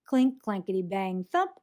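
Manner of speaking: plain reading voice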